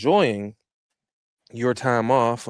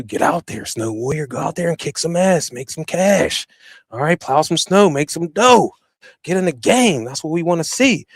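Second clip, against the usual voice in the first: rising, louder hype call to the troops; hype pep talk; 5 semitones higher, 3 dB louder than usual. Real, not acted. Pumping up plow drivers in a hype call. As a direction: fire up the snow crew. Hyping up snow plowers.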